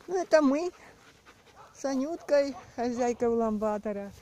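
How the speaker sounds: bright, high vlog greeting; chirpy hello to viewers; excited. Real, not acted. Cheerful vlog intro, high.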